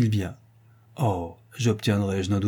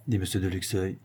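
ordinary delivery, nothing marked

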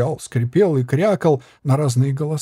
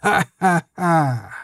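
slow mocking ha-ha-ha; puppet laugh; 3 semitones higher, much slower than usual. A narrator's reading. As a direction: laugh like a jester. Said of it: "рассмеялся он".

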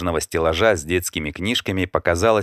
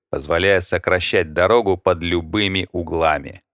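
usual speaking voice

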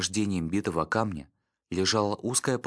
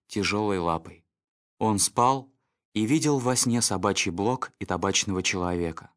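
ordinary delivery, nothing marked

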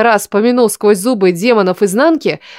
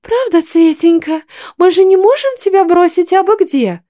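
put-on baby talk; 10 semitones higher than usual. A narrator's reading. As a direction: coo at it like a baby. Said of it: "засюсюкала".

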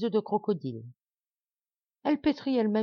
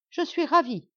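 cheerful delight; 9 semitones higher, 4 dB louder than usual. A narrator's reading. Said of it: "d'un air enjoué".

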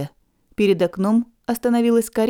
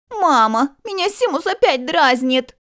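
whiny shout; 7 semitones higher, slower than usual. Acted. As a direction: shout it up the stairs like a tattling child. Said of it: "с громким криком".

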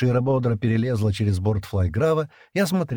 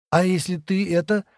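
high, hesitant trailing question; faltering; 10 semitones higher than usual. A narrator's reading. Шира asks Стэн what if, faltering. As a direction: break off before the dreaded word. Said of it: "Шира запнулась".

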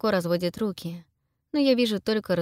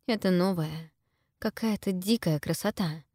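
ordinary delivery

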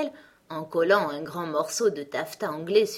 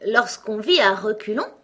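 louder, higher, rising on "vit"; astonished; 5 semitones higher, 8 dB louder than usual. A narrator's reading. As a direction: echo it in disbelief. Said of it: "répéta Alice stupéfaite". (Alice répète, stupéfaite, plus haut et plus fort)

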